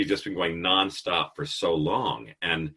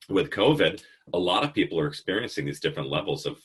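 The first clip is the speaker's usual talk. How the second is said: normal talking voice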